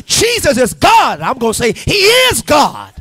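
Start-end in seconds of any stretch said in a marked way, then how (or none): 0.1-1.2 loud, high, slowed proclamation; scream of revelation. Acted. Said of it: "Somebody got to scream one day". 1.7-2.9 loud, high shout leaning on IS; excited scream. Acted. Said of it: "Somebody got to scream one day".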